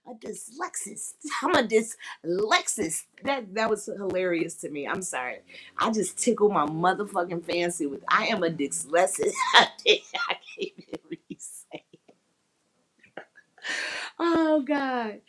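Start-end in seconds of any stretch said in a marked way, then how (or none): 0.1-3.0 giggling word. Real, not acted. Laughing at her own word. 9.4-15.2 laugh, then a breathless whispered trail; too weak from laughing to speak; helpless laughter. Real, not acted.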